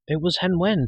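high-pitched anxious protest; upset, pleading objection. A narrator's reading. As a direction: protest in your own defence, voice lifted. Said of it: "Taran protested".

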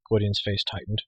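ordinary delivery, nothing marked

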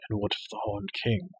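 usual speaking voice

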